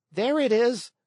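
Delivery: high excited call; excited cry. A narrator's reading. Lampwick sees the wagon coming, high excited cry. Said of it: "cried Lampwick".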